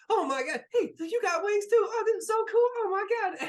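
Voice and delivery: high, squeaky, overexcited little flyer; Barnaby. Acted. Barnaby flying around a winged newcomer, thrilled. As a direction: gush loudly in a very high voice.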